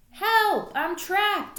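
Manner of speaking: high, loud squeaky call; high squeaky yell; squeaked cry for help. A narrator's reading. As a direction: shout for help from under the bag. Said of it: "I squeaked".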